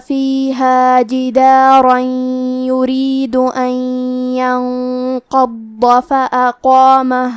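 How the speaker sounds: slow melodic tajweed recitation; Quran recitation. Acted. Reciter chanting the verses slowly for the students. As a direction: chant each phrase long and measured.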